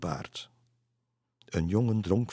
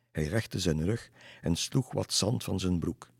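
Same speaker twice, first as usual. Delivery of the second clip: plain reading voice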